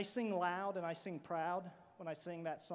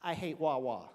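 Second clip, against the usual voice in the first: put-on kid's voice; 4 semitones lower than usual. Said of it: "and this is what he said".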